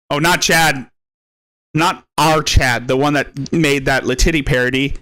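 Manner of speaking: ordinary talk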